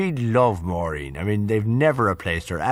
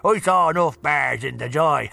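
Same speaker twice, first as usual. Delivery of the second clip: put-on old Dublin accent; 5 semitones higher than usual. [name] joking about his time in jail, old Dublin accent. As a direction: land the joke in broad old Dublin. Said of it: "in the old Dublin accent".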